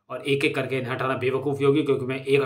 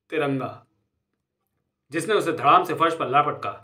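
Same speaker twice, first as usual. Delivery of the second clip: ordinary delivery, nothing marked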